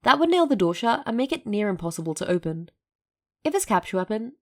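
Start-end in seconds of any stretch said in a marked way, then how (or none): none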